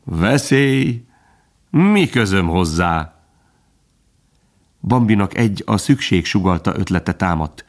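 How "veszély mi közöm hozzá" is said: slow, dismissive drawl; drawn-out, condescending question; smug superiority. A narrator's reading. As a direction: toss it off with a superior smile. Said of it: "és fölényesen mosolygott".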